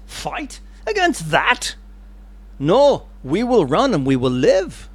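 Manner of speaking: incredulous old soldier, hammed-up; a hint of a balking veteran; lightly voiced old veteran. Old fellow in the crowd answers Wallace, mock disbelief. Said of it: "a veteran, older fellow in the".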